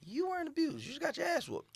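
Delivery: high, scoffing put-down; mocking them in a high voice; mock dismissive voice. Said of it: "I'm like".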